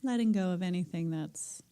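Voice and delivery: calm meditation teacher settling the room; guided meditation. Acted. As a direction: ease the group gently into stillness. Guides breathing and listening.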